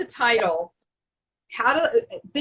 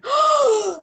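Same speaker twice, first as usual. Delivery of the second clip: surprised gasp; 7 dB louder than usual. Retold or imitated. Gasps on seeing her book.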